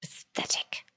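very soft, voiceless whisper; muttered under breath. Acted. Lord scorning the accused, muttering under his breath. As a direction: whisper it disdainfully to yourself. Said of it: "Lord Vandel mutters under his breath".

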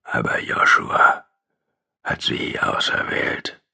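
slow breathy whisper of a dying man. A narrator's reading. The dying man whispers an apology close to his cheek.

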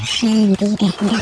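normal talking voice

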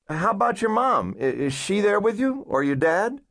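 coaxing caseworker. Acted. Caseworker coaxing a girl to open up, reassuring voice.

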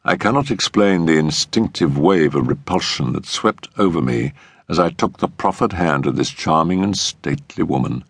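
plain reading voice

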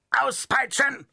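high, harsh puppet voice; mercenary's call. Acted. The mercenary puppet calls for the whip in a high voice. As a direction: bark it like a soldier. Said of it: "rief der Söldner".

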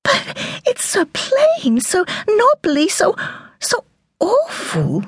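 a mother laments her daughter's chosen name, wailing high and slow. Acted. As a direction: wail it in dismay. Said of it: "wailed Cathy".

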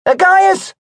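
loud, high call from behind. Acted. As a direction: shout the name over the wheels. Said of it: "A voice cut over ... calling out".

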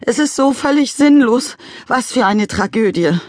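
grieving father. A narrator's reading. Father mourning his dead plant, lamenting. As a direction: wail over the tragedy.

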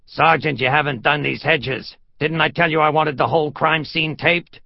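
lieutenant chewing out his sergeant; Lieutenant Brasky. Acted. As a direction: tell off a subordinate officer, loudly. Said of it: "He heard Lieutenant Brasky's voice".

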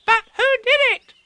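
put-on very high, bright child voice; eager tiny kangaroo voice; excited little Roo. A narrator's reading. Roo asking Eeyore eagerly, very high child voice. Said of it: "asked Roo".

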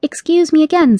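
put-on a polite girl demanding her cat back; firm yet polite young heroine; young Alice. Alice character voice.

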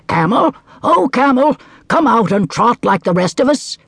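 high, coaxing horse. Acted. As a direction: call the camel brightly. Horse speaking in story.

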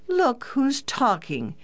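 high, drawn-out mock outrage; pretended anger. A narrator's reading. Daughter teasing back at her brother, mock anger. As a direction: cry it out high and slow, playfully indignant. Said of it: "cried out with pretended anger".

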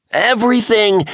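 very high, loud excited declaration; exultant reveal; triumphant. A narrator's reading. James's last excited word.

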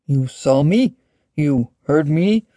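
tentative dragon, lightly voiced; mildly unsure questioning. A narrator's reading. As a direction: ask hesitantly, drawing out the words. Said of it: "the dragon asked tentatively".

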